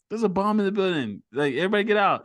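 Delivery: high-pitched mock warning; acted alarm announcement. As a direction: imitate someone announcing an emergency to a room. Acting out film bomb warning.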